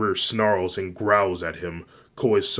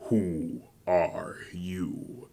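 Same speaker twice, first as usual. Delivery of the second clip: lightly voiced slow, wary questioner; wolf father; much slower than usual. A narrator's reading. Fenrir asks a boy he's never seen, slow light character voice.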